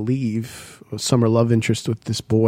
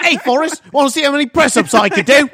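high, loud, fast mock British punk; high mock Young Ones voice; Vivian voice; 11 semitones higher, 6 dB louder than usual. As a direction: fire it off like a cocky cartoon Brit. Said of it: "would sound like Vivian in The Young Ones".

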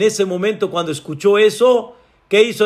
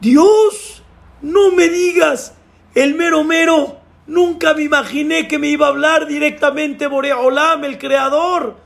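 astonished; 9 semitones higher than usual. Acted. Climber learns God speaks to him, astonished outcry. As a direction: cry out in disbelief.

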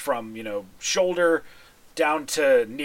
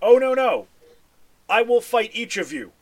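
loud, raised proud warrior's refusal; great warrior; 7 semitones higher, 4 dB louder than usual. Acted. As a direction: swordsman eager to fight them all. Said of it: "he goes".

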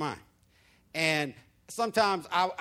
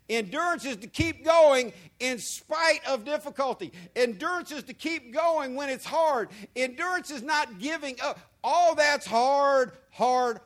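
higher, louder fervent sermon drive; full-force preaching; 8 semitones higher, 5 dB louder than usual. Acted. Pastor urges congregation to endure, preaching loud. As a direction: preach it hard to the church.

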